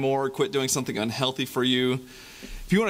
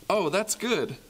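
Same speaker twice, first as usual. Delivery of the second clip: bright, higher hopeful "Oh, that's good"; cheerful; 7 semitones higher, slower than usual. Acted. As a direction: lift "good" up with delight. Call-and-response refrain.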